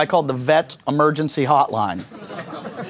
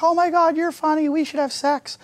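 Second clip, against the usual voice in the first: high, breathy mock valley girl; mock supermodel; 12 semitones higher, 3 dB quieter than usual. Comedian mimicking the supermodel gushing, high mock girl voice. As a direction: gush like a vapid model. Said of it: "She's like".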